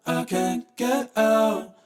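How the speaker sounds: ordinary talk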